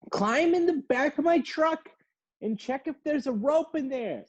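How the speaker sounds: very high, loud mock command; bossy lady voice. Lady ordering the narrator into her truck, very high bossy mock voice. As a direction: bark orders in a squeaky old lady voice. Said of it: "And she's like".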